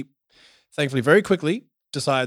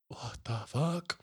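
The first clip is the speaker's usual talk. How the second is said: stunned; 2 semitones lower, 14 dB quieter, much slower than usual. Retold or imitated. Son sees eyeball in hand.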